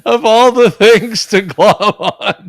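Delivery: high, loud laughing protest; laughing disbelief. Real, not acted. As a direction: protest through real laughter. Laughing at co-host's odd remark.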